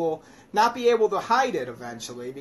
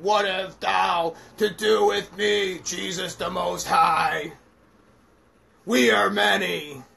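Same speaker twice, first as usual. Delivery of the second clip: demon voice; 2 semitones higher, 4 dB louder, slower than usual. Acted. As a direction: speak as the legion of demons. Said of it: "he begins speaking".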